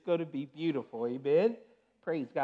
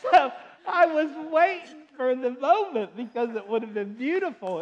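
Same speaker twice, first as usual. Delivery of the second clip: laughing; 8 semitones higher, 7 dB louder than usual. Real, not acted. Laughing while telling the story.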